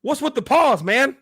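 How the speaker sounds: retelling the punchline in a high bartender voice. Acted. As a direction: squeak the question like the bartender. Bartender line retold in joke.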